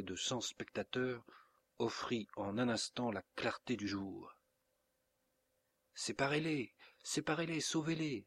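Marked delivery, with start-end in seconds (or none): 5.9-8.2 high, pleading outcry; crying out. A narrator's reading. Isidora begging her parents to stop the fight, crying out. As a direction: plead for help in a raised pitch. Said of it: "s'écria Isidora".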